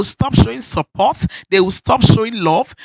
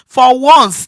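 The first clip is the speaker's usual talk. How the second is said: much louder, hammer it home; emphatic urging; 3 semitones higher, 9 dB louder than usual. Real, not acted. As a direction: punch out for once. Preacher urging wives.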